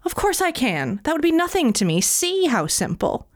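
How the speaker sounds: high, indignant boast; devil's prickly bragging; proud devil. A narrator's reading. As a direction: prove him wrong, stung. Devil boasting, taking the bait.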